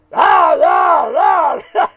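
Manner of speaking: mock ranting yells, loud and high; wordless hammed-up shouts of a speech-maker; Adolf Hitler shouting. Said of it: "the parts where he's shouting".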